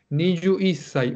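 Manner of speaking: niyu isai said slowly, syllable by syllable; slow demo niyu isai. Shown as a demonstration. Teacher modelling twenty-one for the class, slow. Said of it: "así dicen los japoneses".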